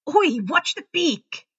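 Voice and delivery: loud, slow protesting yelp; startled yelp. A narrator's reading. Graham pecked by Hestia, yelping in protest. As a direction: jerk back from the beak.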